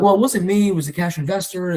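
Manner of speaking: pleading protest. Retold or imitated. Pleading to reel him back.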